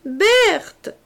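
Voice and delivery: a frantic mother's cry; desperate call. A narrator's reading. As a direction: scream for her in despair. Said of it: "appela la voix désespérée".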